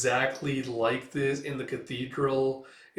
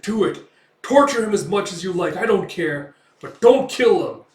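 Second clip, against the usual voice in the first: louder, higher commanding voice; acted loud order; 7 semitones higher, 11 dB louder than usual. The mayor orders the guards to torture the prisoner, loud and commanding.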